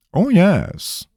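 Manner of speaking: high, amused confirmation; highly amused. A narrator's reading. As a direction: relish it, hugely amused. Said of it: "Eris sounded incredibly amused".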